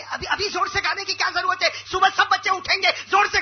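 high, rising exasperated teacher voice; teacher hushes child on a night picnic. Retold or imitated. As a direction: scold the kid for wanting to sing at night.

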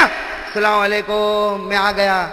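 drawn-out, slow singsong hello; mock husband crooning at the door; sing-song greeting. Husband announces himself at home, slow singsong greeting.

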